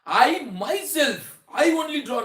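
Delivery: teacher insists loudly on "I myself"; emphatic stress. Shown as a demonstration. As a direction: hammer "I myself" and "only" to insist. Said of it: "So that is called emphatic".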